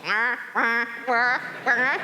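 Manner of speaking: high-pitched mock robot "wah wah" laugh; imitated robot laugh. Speaker mimics the robot's reaction to her son, mock laughing. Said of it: "she starts laughing. She goes".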